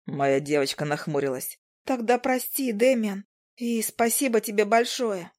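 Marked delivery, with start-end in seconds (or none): 1.9-5.3 put-on higher young woman's voice, sincere thanks; Izi's apology. Izi apologizing and thanking Demian in a higher voice.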